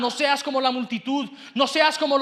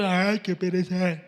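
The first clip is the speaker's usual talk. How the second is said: low, slow mock sigh of reluctance; lazy grumble; 5 semitones lower, slower than usual. Preacher voicing a distracted churchgoer, lazy grumble. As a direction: drag it out like a bored listener. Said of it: "otro pensamiento en este momento".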